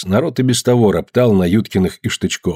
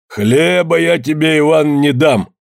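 higher, drawn-out firm refusal; curt refusal; 5 semitones higher, slower than usual. A narrator's reading. Евдоким turns Иван away, shielding his father, firm refusal. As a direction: refuse him outright, word by word. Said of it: "отрезал Евдоким".

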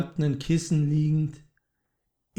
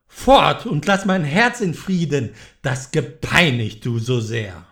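furious banishing; 3 semitones higher, 5 dB louder than usual. Acted. The man orders the raven out, loud and furious. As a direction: drive the demon out with your voice.

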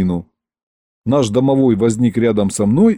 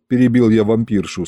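usual speaking voice

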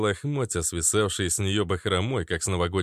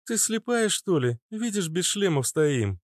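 higher-pitched, impatient woman's retort; mild scolding; 10 semitones higher than usual. Acted. Natasha taps the girl's forehead, scolding. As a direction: tick her off in a raised pitch.

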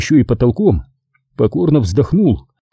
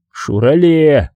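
sullen correction; 3 semitones higher, much slower than usual. A narrator's reading. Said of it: "угрюмо поправил Сабаев".

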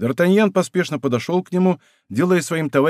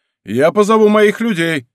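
higher, louder warning; raised-voice threat; 6 semitones higher, 6 dB louder than usual. A narrator's reading. Steward warning the horse takers, raised voice. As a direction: threaten them, voice lifted high.

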